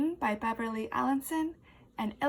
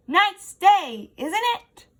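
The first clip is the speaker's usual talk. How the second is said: big loud booming greeting, higher; 8 semitones higher, 11 dB louder, slower than usual. Acted. Effie greets a caterpillar in a booming voice. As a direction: boom it out like thunder. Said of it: "her voice is so loud".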